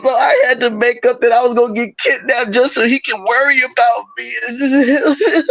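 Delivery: high, hammed-up crying voice; mock tearful excuse. Imagining her blaming the boyfriend who threatened to leave, mock-crying. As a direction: whimper it out like a badly faked sob story.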